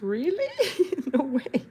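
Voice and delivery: laughing, high no way; laughing disbelief. Real, not acted. Reacting to Fred's dream, laughing disbelief. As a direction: giggle through the surprise.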